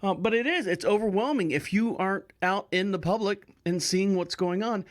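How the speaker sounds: ordinary talk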